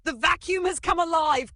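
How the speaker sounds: very high, loud panicked yell. Acted. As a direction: bellow in wild alarm. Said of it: "Uncle Bingo yelled".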